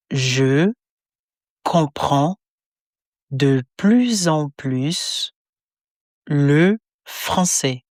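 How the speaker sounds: each word spaced apart for repetition; slow word by word. Shown as a demonstration. Teacher splitting the phrase into slow separate words. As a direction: break it into slow single words.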